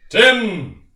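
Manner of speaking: loud, high shout of a name; calling out. Acted. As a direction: announce the name with a sudden burst. Announces his name to interviewer.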